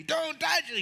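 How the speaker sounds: high mock yell of a mom. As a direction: playfully imitate a mother shouting a warning. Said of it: "your mom's yelling at you".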